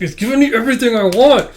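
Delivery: high, loud, fast gushing; real excitement. Real, not acted. Reader overjoyed with the volume, excited outburst. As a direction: burst out in fan delight.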